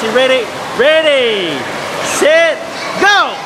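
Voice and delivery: excited shout, pitch climbing to the end; race starter call. Real, not acted. Starting children's race on camera.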